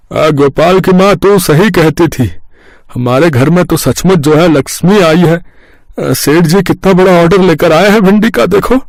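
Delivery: beam over the big order; overjoyed. Acted. Overjoyed father shows big order. (बड़े order पर खुशी से ऊँची, धीमी आवाज़)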